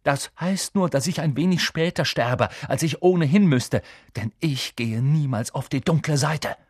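heated determination; defiant. A narrator's reading. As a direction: build to a firm refusal. Defiant vow in heated argument.

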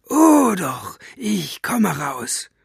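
high-pitched, spiteful villain voice; gloating. A narrator's reading. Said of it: "mit hämischer Stimme".

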